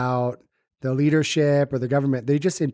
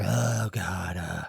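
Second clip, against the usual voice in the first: indulgent groan; 8 semitones lower, 7 dB quieter, much slower than usual. Retold or imitated. Mimics food craving reaction.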